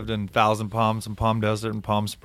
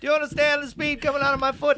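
much higher Chris Tucker voice; Chris Tucker impression; 14 semitones higher than usual. Chris Tucker parody line.

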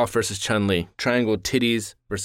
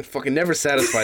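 ordinary talk

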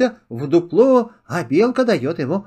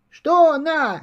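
kitten's voice; 9 semitones higher than usual. Acted. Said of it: "спрашивает котёнок".

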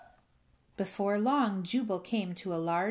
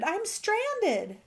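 very high, louder stranded shout; 13 semitones higher, 5 dB louder than usual. A narrator's reading. Bullfrog stranded on a toadstool, shouting. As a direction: call out urgently, pitched way up. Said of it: "he shouted".

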